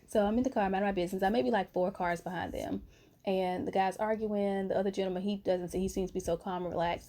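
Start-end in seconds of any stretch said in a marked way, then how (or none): none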